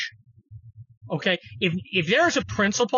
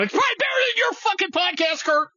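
high shouted heckle; mock listener shout; 11 semitones higher than usual. Host imagines a listener jeering him, high shout. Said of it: "right now you're going".

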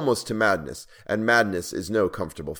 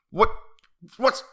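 spluttering start, then high and loud; furious demand; 9 semitones higher, 4 dB louder than usual. A narrator's reading. King turning on the dwarf over a noise, furious. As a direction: stammer with outrage, then burst out. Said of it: "turning furiously to the dwarf".

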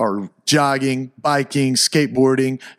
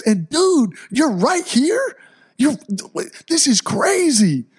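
amazed, excited; 11 semitones higher, slower than usual. Retold or imitated. Telling Steve he's their guardian angel.

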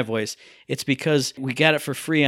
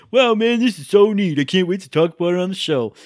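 mock imitation of friend; 5 semitones higher, 5 dB louder than usual. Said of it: "You go".

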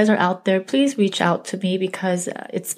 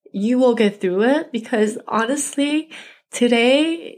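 real grief pushing the voice higher, nearly breaking; emotional, close to tears; 6 semitones higher than usual. Real, not acted. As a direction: voice catching as she holds back tears. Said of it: "I do still feel very emotional".

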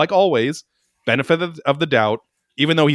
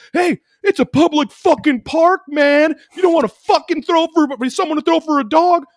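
high-pitched, loud mock tirade; imitated angry yelling; 5 dB louder than usual. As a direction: imitate a belligerent stranger yelling. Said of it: "he was yelling at me".

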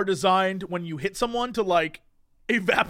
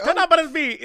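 high, hammed-up fake Italian accent; spaghetti-guy accent; 7 semitones higher than usual. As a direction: say the name in a mock Italian accent.

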